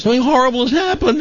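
high, fast, mock-upset little girl voice; upset crying girl. Daughter running crying to her mother, high mock panic. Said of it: "comes running to her mother crying".

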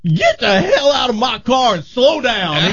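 high, drawn-out mock order with laughter; laughing retold order. Host quoting a cop's order to get out, laughing. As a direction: quote the cop while cracking up.

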